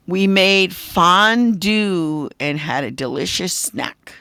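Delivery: slow and loud, FONDUE drawn out; dictated example sentence. Shown as a demonstration. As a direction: dictate slowly, stretching FONDUE into two parts. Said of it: "give you a sentence with the word".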